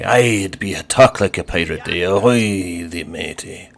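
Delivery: a hint of a hammy pirate. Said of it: "'cause it's Talk Like a Pirate Day".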